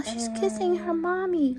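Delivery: usual speaking voice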